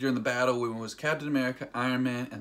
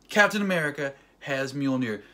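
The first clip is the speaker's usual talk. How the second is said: ordinary delivery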